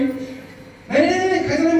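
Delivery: high-pitched imitation of repeated refusals; forbidding wife. Wife forbids husband to eat the coconut, high mock refusal. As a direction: mimic a wife's quick, high veto.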